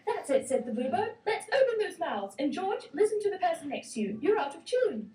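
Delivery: high, brisk bird conductor voice; choir conductor bird. Acted. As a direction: direct the choir like a fussy little bluebird.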